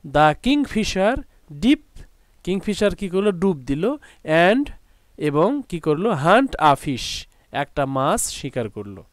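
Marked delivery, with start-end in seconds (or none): 0.0-2.0 drawn out slowly, rising high on "dip"; slow model sentence. Shown as a demonstration. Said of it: "এরকম তৈরি করতে পারি". 6.1-7.3 each word drawn out very slowly; slow model sentence. Shown as a demonstration. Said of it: "এরকম তৈরি করতে পারি".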